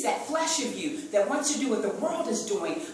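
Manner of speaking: ordinary talk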